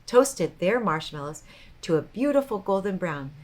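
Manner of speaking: ordinary delivery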